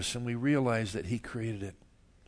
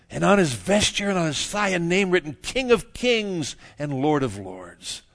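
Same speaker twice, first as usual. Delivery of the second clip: loud, ringing reading that slows at the end; scripture proclamation; 6 semitones higher, 8 dB louder than usual. Acted. Preacher reading Christ's title to the congregation, grand proclamation.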